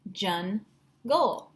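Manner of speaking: JUNGLE as jun, pause, gol; split into syllables. Shown as a demonstration. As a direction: break JUNGLE into two parts. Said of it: "breaking it into its two parts".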